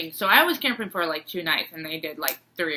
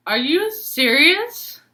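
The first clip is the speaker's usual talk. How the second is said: stunned disbelief; 8 semitones higher, 3 dB louder, slower than usual. Retold or imitated. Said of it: "And I was like".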